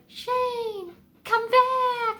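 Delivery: put-on high, loud whiny child cry of Shane, come back; mock kid's wail. Quoting the boy, a high loud whiny call. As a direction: wail it like the kid at the film's end. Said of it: "when he says, like".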